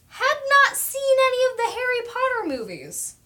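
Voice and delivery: high, loud scandalised reveal; playful scandalised call-out; mock outrage. Real, not acted. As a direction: expose the shocking truth with delight. Calling out a viewer.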